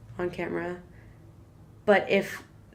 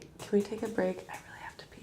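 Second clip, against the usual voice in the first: half-whispered, much softer request; hushed aside; 10 dB quieter, faster than usual. Real, not acted. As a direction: slip in a quiet off-mic aside. Asks aside for a bathroom break.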